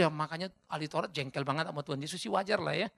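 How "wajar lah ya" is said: usual speaking voice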